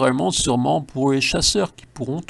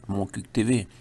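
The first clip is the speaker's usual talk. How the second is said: ordinary talk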